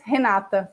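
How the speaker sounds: Renata with a breathy h at the start, heh-NAH-tah; Brazilian pronunciation. Shown as a demonstration. Guest showing the host how to say her name, Brazilian pronunciation. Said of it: "Brazilian accent".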